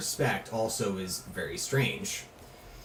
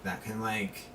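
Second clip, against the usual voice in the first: put-on valley girl voice; 7 dB quieter than usual. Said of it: "the voice you have for Topaz".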